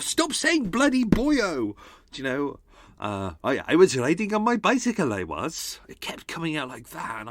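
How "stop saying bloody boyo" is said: high, slow mock telling-off; mock scolding himself. Host retelling how he scolded himself over the Welsh slips, hammed-up. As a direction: scold yourself in jest. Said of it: "saying to myself".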